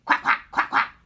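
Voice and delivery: loud, unvoiced quack imitation; mother duck urging him to follow.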